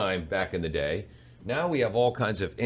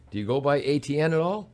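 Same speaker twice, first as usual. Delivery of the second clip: usual speaking voice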